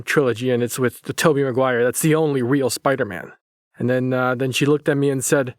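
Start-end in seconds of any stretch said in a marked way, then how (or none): none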